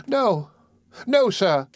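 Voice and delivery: put-on high, pleading small-boy voice; acted tearful protest; sobbing child. A narrator's reading. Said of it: "sobbed Oliver".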